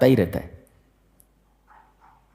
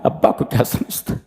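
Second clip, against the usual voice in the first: breathy scornful whisper of a rude youth; imitating today's youth in a scornful whisper; mocking whisper; 7 semitones higher, 4 dB quieter than usual. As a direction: hiss out the youngsters' taunt. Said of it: "ये आजकल के नौजवान हैं".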